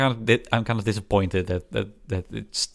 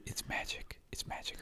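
whispering; 19 dB quieter than usual. Pretend. Mock awe at actual content.

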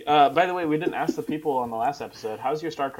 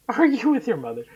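mocking echo; 10 semitones higher, 5 dB louder than usual. Mocking the other's line back.

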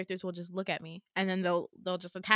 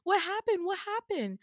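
panicked roommate; 9 semitones higher than usual. Retold or imitated. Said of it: "She's like".

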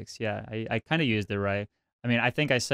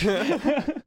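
laugh; 13 semitones higher than usual. Real, not acted. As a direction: burst out laughing. Laughing at own story.

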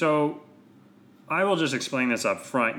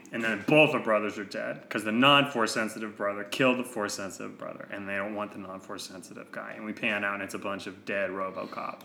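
ordinary talk, nothing marked

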